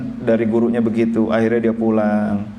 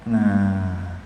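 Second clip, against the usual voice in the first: normal talking voice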